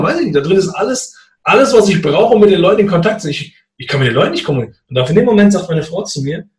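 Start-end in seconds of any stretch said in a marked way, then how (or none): 0.0-4.8 agitated panic. Retold or imitated. Panicking about locked phone.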